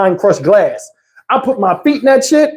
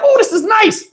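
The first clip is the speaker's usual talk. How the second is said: mock Tony; 12 semitones higher than usual. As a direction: send up Tony pretending it's pleasant. Tony in the cold water.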